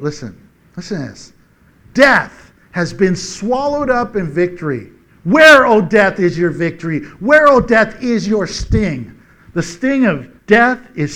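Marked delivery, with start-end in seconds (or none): 1.9-9.2 loud, ringing proclamation; preaching scripture. Acted. Preacher proclaiming victory over death to the church, ringing. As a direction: declare it with triumph.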